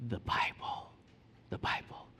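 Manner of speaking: unvoiced whisper; hushed reply. Dad answering his curious son, whispered. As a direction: whisper it like a revealed secret.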